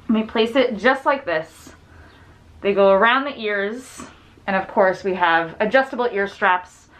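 muffled behind cloth mask; speaking into fabric mask; through a face mask. Real, not acted.

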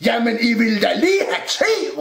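high, loud cupboard voice; shouted protest. A narrator's reading. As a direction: holler back in objection. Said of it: "råbte skabet". (råber protesterende)

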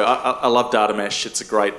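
ordinary delivery

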